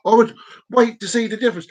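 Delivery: ordinary talk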